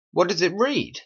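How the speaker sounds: ordinary talk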